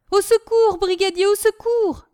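very high, loud cry for help; calling for help. A narrator's reading. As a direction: yell for the brigadier's help. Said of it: "continua-t-il". (appel au secours aigu et fort)

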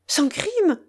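high, shocked repeat of crime; shocked echo. Acted. Echoes his word in shock.

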